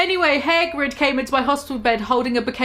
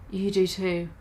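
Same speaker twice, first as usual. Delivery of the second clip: "You do too" said softer and lower, sad; sadly; 5 semitones lower, 7 dB quieter than usual. Shown as a demonstration. Goth girl answering Bloody Mary sadly, "You do too" soft and low. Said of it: "I said sadly too".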